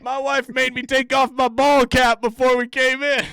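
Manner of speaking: hammed-up impression of the man talking to Swanee; mock guy. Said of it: "That guy's like".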